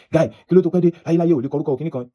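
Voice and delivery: mock the guard yelling at him in Yoruba. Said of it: "He was also raising his voice".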